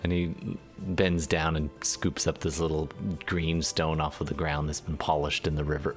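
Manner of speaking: ordinary delivery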